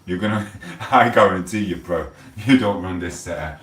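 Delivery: usual speaking voice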